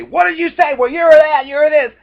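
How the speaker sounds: high, loud heated comeback; mock angry retort. Shown as a demonstration. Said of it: "their normal reaction is to say".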